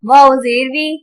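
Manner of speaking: measured sing-song spelling, long held final syllables; Qaida spelling drill. Shown as a demonstration. Teacher spelling tanween words aloud for children, sing-song. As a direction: chant each letter and sound like a reading drill.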